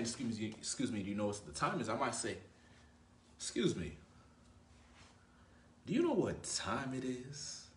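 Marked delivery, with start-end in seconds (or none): 3.4-7.7 slow, drawn-out playful question with a rise; intriguing, slow ask. Shown as a demonstration. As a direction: ask the time slowly, teasing, to make her curious. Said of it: "Why would I say it like that?".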